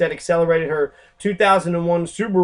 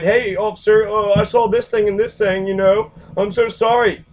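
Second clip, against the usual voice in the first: higher, rattled voice of a shaken bystander; imitated upset witness; 5 semitones higher, 4 dB louder than usual. Bystander driver talking to the officer, higher and rattled. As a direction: stammer it to the officer, apologetic and shaken. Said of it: "Here's another car that's coming up".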